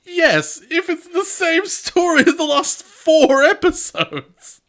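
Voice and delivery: high, loud and slow exasperated complaint; fed-up fan ranting. Real, not acted. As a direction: whine about the repeated plot. Groaning about repeat plot.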